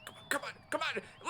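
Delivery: urgent, high-pitched come on; hurrying someone. Real, not acted. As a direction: egg him on, fast and eager. Urging player during a play.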